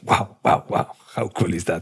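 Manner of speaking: breathy whispered wow of wonder; hushed amazement at the city; whispered awe. Retold or imitated. Tourist in London, whispered amazed wow. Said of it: "Something like".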